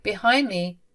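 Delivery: ordinary delivery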